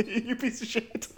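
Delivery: high, slow laughing jab. Real, not acted. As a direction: laugh it out teasingly. Laughing insult to cohost.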